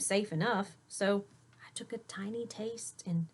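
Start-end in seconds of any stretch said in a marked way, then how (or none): 1.7-3.0 hushed whisper. A narrator's reading. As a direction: whisper it, cautious. Cautious first bite.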